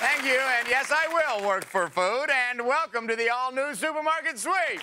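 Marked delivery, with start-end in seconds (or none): 2.3-4.8 big shouted show welcome; excited welcome. Acted. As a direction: throw it out to the audience with energy. Host's opening welcome.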